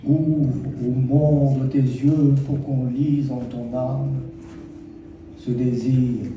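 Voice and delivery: unhurried verse, pausing after each phrase; poetry recital. Acted. Poet addressing Astia at twenty in verse, poetry recital. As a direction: declaim it as a poem, letting key words linger.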